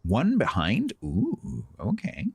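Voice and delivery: high, surprised ooh and okay. Real, not acted. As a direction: caught off guard, pitch jumping up.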